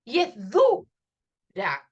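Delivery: "dura" with DU punched loud, then a pause before "ra"; slow "dura", strong first syllable, detached ending; stressed syllable. Shown as a demonstration. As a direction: hit the U in "dura" and split the word. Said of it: "que suena fuerte".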